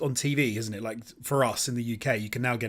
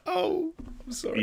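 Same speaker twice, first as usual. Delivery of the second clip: mock apology; 6 dB quieter than usual. Reacting to a bland dish.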